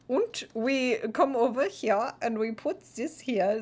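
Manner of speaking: a hint of a German tourist in a German accent; mock German accent. Streamer hams up a German accent for chat, lightly. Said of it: "No, I just do funny voices".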